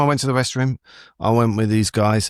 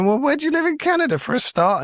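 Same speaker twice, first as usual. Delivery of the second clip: high, put-upon mock protest; indignant challenge. Comedian replays questioning a mistaken woman, indignant.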